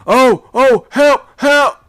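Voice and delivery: very high, loud terrified cry; an old sailor crying out in terror; frightened shout. A narrator's reading. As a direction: shriek for help. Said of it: "shout in a frightened voice".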